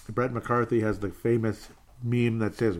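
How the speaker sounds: ordinary talk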